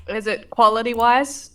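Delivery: normal talking voice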